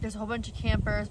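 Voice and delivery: ordinary talk, nothing marked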